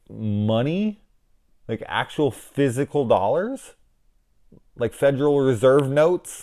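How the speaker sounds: high, disbelieving questions, slowing down; mock-stunned double take at fake money; incredulous. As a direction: ask it like you can't believe your eyes. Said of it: "and I was like".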